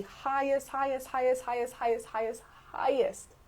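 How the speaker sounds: HIGHEST hammered out over and over, each one stressed; insistent, evenly stressed repeats of HIGHEST; emphatic repetition. Real, not acted. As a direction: punch every HIGHEST, drawing out the last. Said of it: "Do you hear me emphasizing the word highest".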